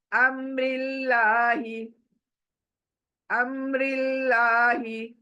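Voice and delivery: slow अम्रिल्लाही with a light, thin लाम; thin Allah. Shown as a demonstration. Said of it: "अमरी जेर की वजह से अल्लाह खींच कर पढ़ेंगे".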